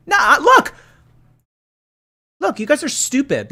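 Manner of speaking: high, loud indignant protest; exasperated outburst. Real, not acted. Streamer scolding chat over whose song it is, exasperated.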